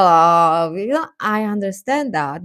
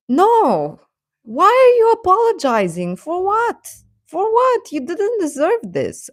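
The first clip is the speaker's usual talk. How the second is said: much higher, outraged plea to a friend; indignant pep talk; 11 semitones higher than usual. Retold or imitated. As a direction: defend your friend in disbelief. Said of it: "I would tell him".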